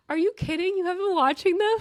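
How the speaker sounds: high, loud disbelief; loud, high-pitched astonished questioning; incredulous outburst. Real, not acted. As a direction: exclaim it, scandalized. Reacts with disbelief to co-host.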